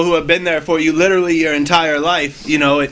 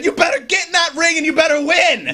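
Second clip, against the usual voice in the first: much higher, bossy mother's order; stern mom; 10 semitones higher than usual. Retold or imitated. Wrestler retells his mother ordering him into the ring, much higher and bossy. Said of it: "and she said".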